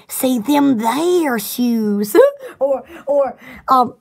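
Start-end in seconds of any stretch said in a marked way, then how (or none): 0.1-2.2 slow drawled Southern phrase; Southern slang. Shown as a demonstration. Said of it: "we're talking about some Southern slang".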